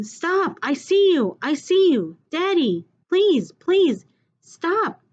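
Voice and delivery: put-on boy screaming at his father to let go; pleading shout. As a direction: plead desperately with Daddy to stop. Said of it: "I'm going".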